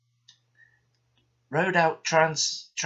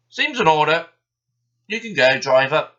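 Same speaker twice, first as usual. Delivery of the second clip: louder, grudging foreman voice; gruff foreman; 4 semitones higher, 10 dB louder than usual. A narrator's reading. Foreman letting the driver go, loud and grudging. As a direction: wave them through reluctantly.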